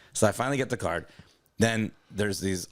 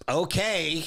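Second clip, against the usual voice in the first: high, drawn-out doubting okay; mock a disbelieving manager; skeptical okay; 9 semitones higher than usual. Manager humoring a customer she doesn't believe, skeptical. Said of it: "still doesn't believe me".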